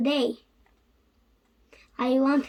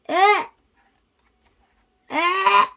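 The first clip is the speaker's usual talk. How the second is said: building sneeze; 6 semitones higher, 5 dB louder than usual. Acted. Gerald struggles before a sneeze.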